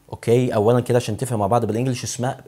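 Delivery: ordinary talk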